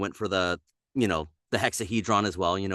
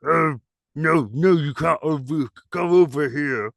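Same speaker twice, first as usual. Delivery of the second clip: higher, lip-bitten ogre voice; dumb ogre voice; 5 semitones higher, 5 dB louder than usual. Shown as a demonstration. Ogre audition line with lip bitten, pitched up. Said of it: "bite the lip".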